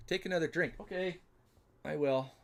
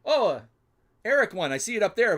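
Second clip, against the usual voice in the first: higher, louder happy reveal; excited announcement; 7 semitones higher, 8 dB louder than usual. Real, not acted. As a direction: call the winner out gladly. Spots the winner.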